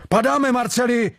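high, urgent cry; panicked alarm. A narrator's reading. Sailor warning his mate to flee, panicked. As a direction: yell to get away, pitched well up.

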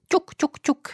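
high, crisp knock-knock sounds; woodpecker knocking. A narrator's reading. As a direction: tap it out like a beak on wood. Woodpecker drilling again. (vysoké ostré ťukání datla)

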